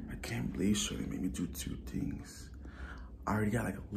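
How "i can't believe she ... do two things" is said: whispering. Real, not acted. Whispered aside to camera.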